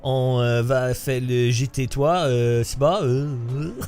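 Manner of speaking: put-on relaxed French words in a light Belgian accent. Streamer greeting viewers in French, light Belgian accent. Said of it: "that was my Belgium accent".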